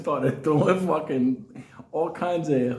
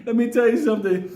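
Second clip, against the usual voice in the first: ordinary delivery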